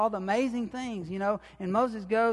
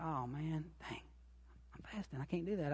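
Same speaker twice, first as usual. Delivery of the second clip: much softer, muttered private dismay; inner thought; 4 semitones lower, 14 dB quieter than usual. Retold or imitated. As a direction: grumble it to yourself, half under the breath. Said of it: "thinking that in my head".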